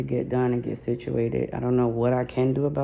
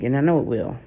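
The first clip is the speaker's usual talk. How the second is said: ordinary talk, nothing marked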